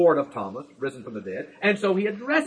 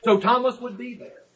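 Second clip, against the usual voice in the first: normal talking voice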